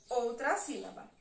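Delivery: ordinary delivery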